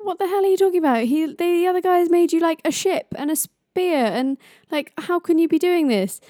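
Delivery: high, fast, indignant Loki outburst; outraged protest. Acted. Loki objecting to the gods' judgment, high and indignant. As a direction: squeal your disbelief at the verdict.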